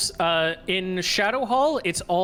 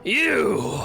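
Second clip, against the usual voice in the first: angry shout; 6 semitones higher, 3 dB louder than usual. Acted. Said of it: "as he goes".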